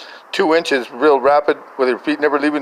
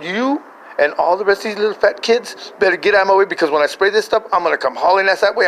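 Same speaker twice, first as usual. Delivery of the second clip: higher-pitched exasperated threat; mock-stern ultimatum to family; retold warning; 6 semitones higher than usual. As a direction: warn them off emphatically. Warns mom and kids off.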